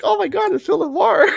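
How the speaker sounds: very high retold fanboy excitement; acted high-pitched celebrity-sighting thrill; starstruck fan squeal. Acting out his starstruck reaction.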